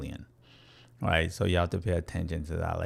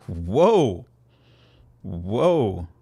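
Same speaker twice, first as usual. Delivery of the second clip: mock whoa; 6 dB louder than usual. Mock alarm at a gun.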